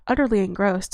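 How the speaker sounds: usual speaking voice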